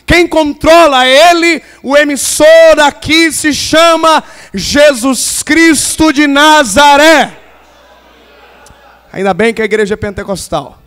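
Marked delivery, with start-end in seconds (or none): 0.1-7.4 loud, drawn-out proclamation from the pulpit; emphatic preaching proclamation. Real, not acted. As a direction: build to a big, slow declaration of the name. Preacher proclaims who is in control.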